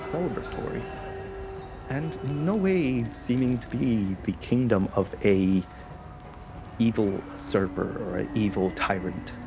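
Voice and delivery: ordinary talk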